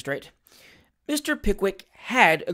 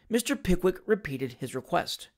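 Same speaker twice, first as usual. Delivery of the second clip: ordinary delivery, nothing marked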